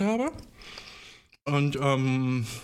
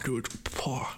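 breathy whisper; 8 dB quieter than usual. Real, not acted. Overwhelmed by the nut's effect.

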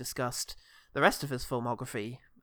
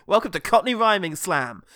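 loud, higher game-show welcome; mock announcer; 4 semitones higher, 11 dB louder than usual. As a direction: announce it like a slam host.

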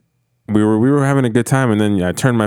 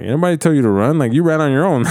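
higher-pitched, acted-out telling-off; scolding retort; 6 semitones higher than usual. Party host answering complainers, scolding retort. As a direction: throw the blame back at them.

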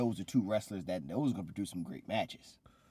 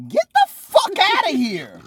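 much higher, much louder slow outraged yell; real angry shout; 18 dB louder, slower than usual. Real, not acted. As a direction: explode in mock-real outrage. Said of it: "you got mad for real".

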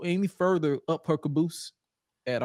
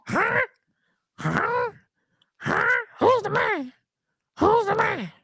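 much higher, nasal squeak; nasal squeaky voice. Retold or imitated. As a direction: squeak it through the nose. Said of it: "sound like it's coming out his nose".

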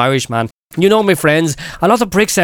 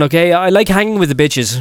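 usual speaking voice

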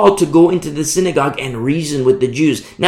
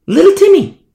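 much higher and louder, falling on Timmy; high, loud exclaimed name; 14 semitones higher, 7 dB louder than usual. Acted. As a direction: call out the boy's name with pity. Preacher exclaims about the boy.

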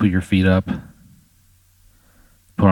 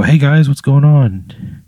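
bright, slow, raised hello to the neighbors; friendly greeting; 6 semitones higher, 5 dB louder, slower than usual. Pretend. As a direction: call out a cheery, drawn-out hello. GM voices player greeting neighbors.

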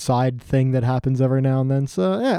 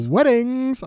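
high drawn-out; 9 semitones higher than usual. Acted. Echoes wedding, high slow.

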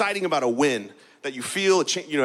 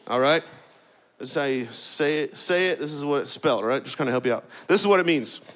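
normal talking voice

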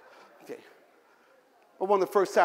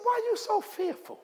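mild rebuke; 3 dB quieter than usual. Acted. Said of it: "He said".

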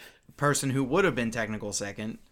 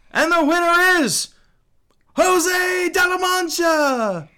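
high, slow, booming champion call; announcer voice; 10 dB louder, slower than usual. Acted. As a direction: drag out the winner's name like a ring announcer. GM as tournament announcer.

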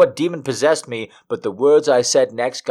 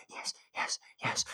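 soft breathy croak; croaked whisper; 20 dB quieter than usual. A narrator's reading. Narrator answers him breathless, croaked whisper. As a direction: croak it out barely voiced. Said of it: "I croak out".

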